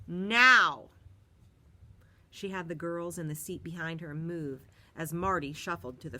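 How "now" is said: loud barked order; sharp command. A narrator's reading. Bus driver barks at Marty to move. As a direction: snap the order.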